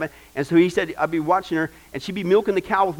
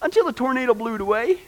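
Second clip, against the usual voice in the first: deadpan grandma; 6 semitones higher than usual. Retold or imitated. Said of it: "she goes".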